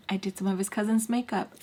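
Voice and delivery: ordinary delivery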